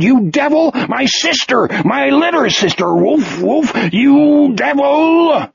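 high, wailing accusations; anguished scream. A narrator's reading. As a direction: scream in grief and terror, stretching the last cry. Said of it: "Wolf screamed".

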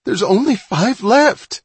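high, loud, drawn-out cry; dismayed cry. A narrator's reading. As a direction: cry out in alarm at the shortage. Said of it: "he cried".

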